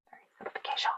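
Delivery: breathy whispered apology, off to the side; murmured aside about phone notifications. Real, not acted. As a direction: drop to a whisper for a quick sorry. Aside while checking her phone.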